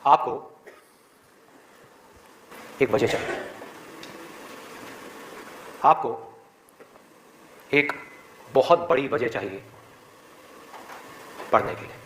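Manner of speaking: very low pitch, long pauses between phrases; weighty reveal. Real, not acted. Speaker revealing the one line to students, grave and halting. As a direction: deliver it piece by piece, low and deliberate.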